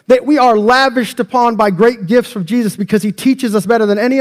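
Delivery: high, loud, driving sermon climax; preaching at full force. Real, not acted. Pastor to church on why Jesus came, preaching at full force. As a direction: build the congregation up with fervor.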